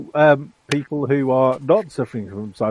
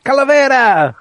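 calling out; 11 semitones higher, 7 dB louder than usual. Acted. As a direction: shout the name across the room. Calling out to a fellow character.

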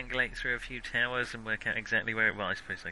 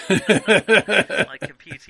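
laughing; 6 semitones higher, 11 dB louder, much slower than usual. Real, not acted. Laughing mid-line.

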